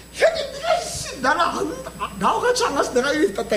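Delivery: put-on high, whining kid brother; whiny younger brother. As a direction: whine it like the brother blaming his elder. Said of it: "이런 줄 아네, 이놈이". (남 탓하는 동생을 흉내 내는 징징대는 높은 목소리)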